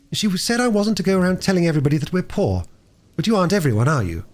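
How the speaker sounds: put-on a worried girl pleading with the doctor; a hint of a nervous child; earnest young girl. A narrator's reading. Roberta voiced throughout dialogue.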